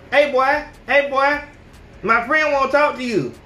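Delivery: calling him over for her friend; mock girl calling out.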